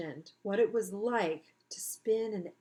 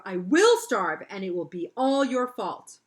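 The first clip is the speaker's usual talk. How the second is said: stubborn threat; 11 dB louder than usual. Acted.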